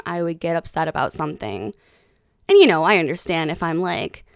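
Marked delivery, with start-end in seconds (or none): none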